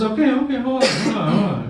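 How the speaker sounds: high, eager stalling; rallying himself up; buddy getting ready. Retold or imitated. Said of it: "he goes".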